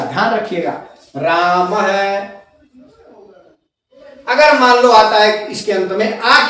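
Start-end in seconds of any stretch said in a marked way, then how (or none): 1.2-2.4 राम है drawn out as "raa-ma-ha"; visarga echoing A. Shown as a demonstration. Teacher showing how रामः is spoken, slowly ending "ha". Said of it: "बोला ऐसे जाएगा".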